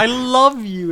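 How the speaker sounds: put-on high, loud slurred drunk voice; drunken declaration. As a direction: slur it like a drunk stranger. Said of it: "this drunken guy".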